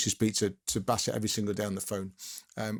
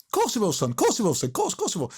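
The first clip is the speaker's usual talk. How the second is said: imitating a manager's higher-pitched, repeated reassurance; retold quick brush-off, voice lifted high; breezy assurance; 8 semitones higher than usual. Manager waving away a reporter's condition, breezy assurance.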